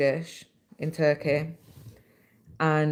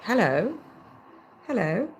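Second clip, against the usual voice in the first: mock posh British accent; 7 semitones higher than usual. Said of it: "people do, like, a British accent and it's always like".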